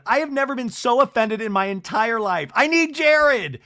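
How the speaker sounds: high, loud wail of outrage; hammed-up offense. Brittany walking out offended, hammed-up rising shout.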